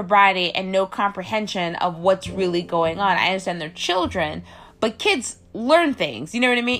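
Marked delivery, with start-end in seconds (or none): none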